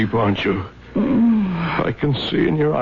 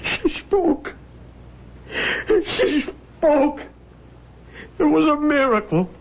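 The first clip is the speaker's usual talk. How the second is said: much higher, tearful wonder; amazed crying; 10 semitones higher than usual. Acted. Widower marvelling that his wife spoke, tearful. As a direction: break into awed sobbing joy. Husband after wife's last words.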